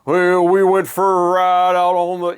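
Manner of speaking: slow, drawn-out mock [name] delivery; [name] talking voice. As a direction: lazily spin it out like the Boss on a record. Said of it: "this way he talks about".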